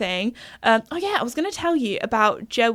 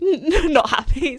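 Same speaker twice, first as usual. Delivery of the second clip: laughing; 5 semitones higher, 3 dB louder, much slower than usual. Retold or imitated. Grumpy horse, laughing.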